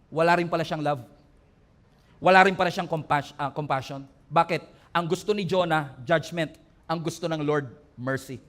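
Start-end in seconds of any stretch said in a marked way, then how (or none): none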